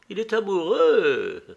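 put-on high-pitched old lady's cry; lightly acted exclamation; exclaiming. A narrator's reading. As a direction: exclaim it high, as the lady hostess. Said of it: "s'écria Lady Narborough".

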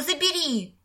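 high nasal whine; гундосое нытьё. A narrator's reading. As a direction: whine it nasally like a silly child. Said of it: "загундосил Петька". (гундося, высоким голосом)

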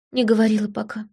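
whispers her answer. A narrator's reading. Сана whispers to the narrator. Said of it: "покачала головой Сана".

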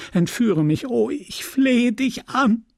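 high, slow, beseeching cry; imploring plea. Acted. Emma implores Rodolphe to take her away, high slow plea. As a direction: stretch out the begging, voice soaring.

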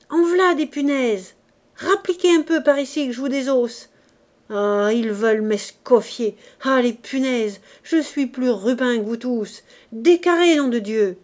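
loud, high-pitched raging shouts; worked-up, belligerent outburst; furious yelling. A narrator's reading. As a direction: rail at a gang of imagined attackers. Delirious man yelling at imagined enemies.

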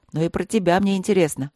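usual speaking voice

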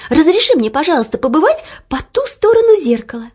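high, loud eager begging; pleading. Acted. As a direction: beg sweetly and eagerly. Girl begging the mirror.

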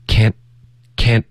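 "can't" chopped off with a held T; short, abruptly stopped "can't"; clean stop. Shown as a demonstration. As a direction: end "can't" in a crisp pause, no T release. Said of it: "一个干脆的停顿".

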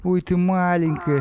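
high, slow cooing; baby talk. Acted. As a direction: coo at a kitten, slow and high. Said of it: "сюсюкал Стас".

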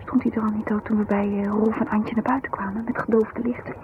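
hushed, wary whisper; whispering about the car tailing them. Acted. Suspicious car behind them.

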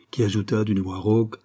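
usual speaking voice

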